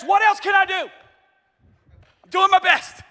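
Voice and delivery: high loud whiny plea; tired husband pleading with his wife; exasperated spouse. Retold or imitated. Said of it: "we use words like this".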